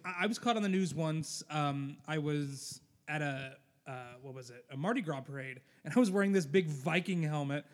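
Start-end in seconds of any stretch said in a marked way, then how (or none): none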